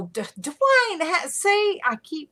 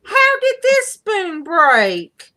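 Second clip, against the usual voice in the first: surprised; 10 semitones higher, 6 dB louder, much slower than usual. Real, not acted. Finds a broken spoon.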